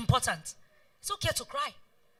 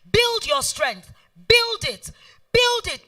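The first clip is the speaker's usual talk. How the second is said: slow, higher and louder, driving each build home; emphatic urging; 7 semitones higher, 6 dB louder, much slower than usual. Real, not acted. Speaker urging business owners to build strengths, louder and slower. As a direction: push every word like a coach. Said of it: "urging the audience".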